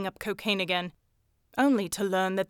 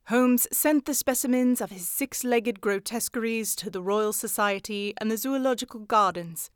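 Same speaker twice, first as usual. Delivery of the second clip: usual speaking voice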